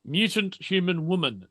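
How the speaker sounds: ordinary delivery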